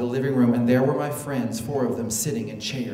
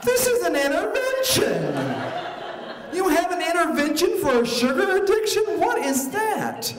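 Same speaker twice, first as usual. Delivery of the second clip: outraged disbelief. Acted. Birthday girl discovers it's an intervention, appalled. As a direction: screech in appalled astonishment.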